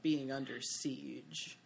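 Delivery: normal talking voice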